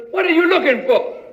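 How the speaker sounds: normal talking voice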